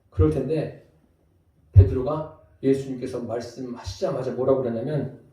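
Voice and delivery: ordinary talk, nothing marked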